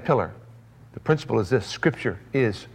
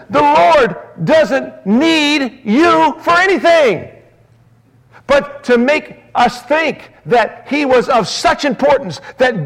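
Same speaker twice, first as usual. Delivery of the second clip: high, loud, fervent pulpit preaching; full-force sermon, much higher and louder; 14 semitones higher, 7 dB louder than usual. Acted. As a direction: thunder it out from the pulpit. Preacher rebukes TV preacher.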